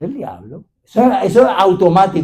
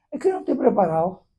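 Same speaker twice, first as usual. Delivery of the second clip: mock timid excuse; 3 semitones lower, 11 dB quieter, faster than usual. Mimics believer declining to lead.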